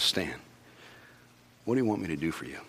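soft, tender asking; Jesus tenderly asking the blind man; gentle question. Retold or imitated. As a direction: ask him quietly and kindly.

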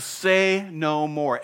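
lofty, drawn-out reassurance; commanding interruption. Acted. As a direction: cut in grandly and slowly. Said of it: "he interrupts the cat".

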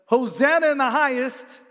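a hint of joyful acclaim; crowd praising.

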